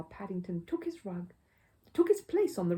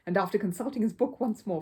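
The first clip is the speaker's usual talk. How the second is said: plain reading voice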